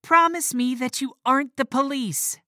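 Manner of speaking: loud, high shout through the door. A narrator's reading. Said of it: "she shouted".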